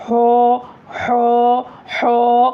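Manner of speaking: हो with rounded lips, the wrong way. Shown as a demonstration. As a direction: demo the rounded mistake. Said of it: "गोल गोल भी नहीं कर देना". (गलत गोल हो)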